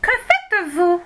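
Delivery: put-on high, commanding old woman; repeated, high voice. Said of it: "répéta la voix".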